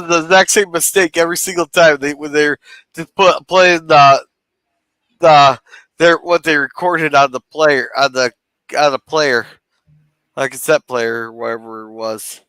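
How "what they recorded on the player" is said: ordinary talk, nothing marked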